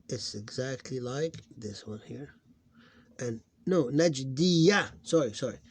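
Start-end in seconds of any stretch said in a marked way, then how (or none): 3.9-4.9 "Najdiya" loud and high, ending on a clear ya; corrected syllables. Shown as a demonstration. Teacher correcting their own reading, emphatic and clear. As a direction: sound out "Najdiya" with di and ya distinct. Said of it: "It's not that".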